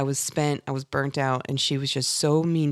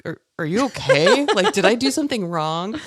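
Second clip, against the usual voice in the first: worried husband voice; 3 semitones higher, 5 dB louder than usual. Retold or imitated. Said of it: "my husband was like".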